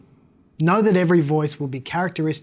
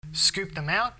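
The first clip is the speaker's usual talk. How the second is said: softer, hollow scooped-out band; 3 semitones higher, 6 dB quieter, slower than usual. Shown as a demonstration.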